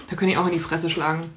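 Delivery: ordinary talk, nothing marked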